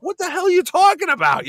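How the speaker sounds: high-pitched retold bafflement; mock incredulous outburst. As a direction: shoot the pitch way up in comic disbelief. Retold bafflement at tall tale.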